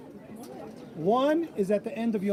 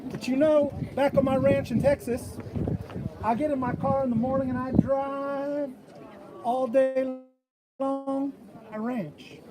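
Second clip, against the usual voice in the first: a light Texan southern drawl, a bit higher; 3 semitones higher than usual. Retold or imitated. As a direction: play the Texan telling a tall tale in a southern drawl. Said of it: "I still had my southern drawl".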